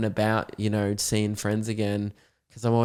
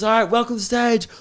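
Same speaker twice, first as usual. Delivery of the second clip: stage announcer; 10 semitones higher, 3 dB louder than usual. Retold or imitated. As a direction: announce him onto the stage. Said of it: "and then he goes".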